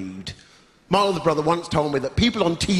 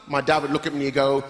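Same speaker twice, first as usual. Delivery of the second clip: ordinary talk, nothing marked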